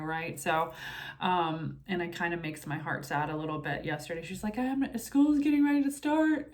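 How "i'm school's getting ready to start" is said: higher, mock-dramatic kid's lament; dramatic child. Mother mimicking her daughter's dramatic complaint, higher mock whine. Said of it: "she's so dramatic".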